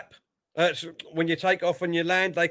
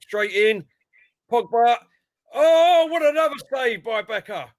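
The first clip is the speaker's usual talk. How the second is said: louder, high, excited play-by-play; live football commentary; 7 semitones higher, 6 dB louder than usual. Real, not acted. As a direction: build to an exclamation at the save.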